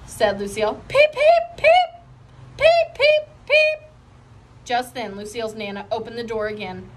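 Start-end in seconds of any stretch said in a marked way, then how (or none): 0.9-3.9 very high, louder peeps; giddy peeps. A narrator's reading. Lucille peeping out of control at bedtime, high and loud. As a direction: chirp wildly out of control.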